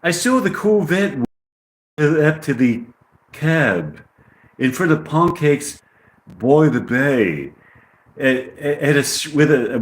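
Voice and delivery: put-on halting amateur English accent; British accent. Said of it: "I get the British accent".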